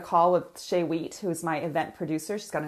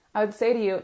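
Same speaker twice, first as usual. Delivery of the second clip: ordinary talk, nothing marked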